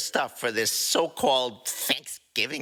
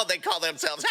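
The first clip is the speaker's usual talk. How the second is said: high laughing line. Acted. Rocket laughing at Walmart joke, high giggle. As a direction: giggle through the words.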